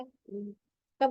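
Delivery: ordinary talk, nothing marked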